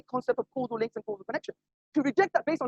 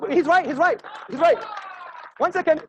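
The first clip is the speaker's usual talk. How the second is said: raised, higher voice, cutting in; calling over applause; 7 semitones higher, 5 dB louder, slower than usual. Real, not acted. Debater to applauding audience, calling over them. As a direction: call out to settle a clapping crowd.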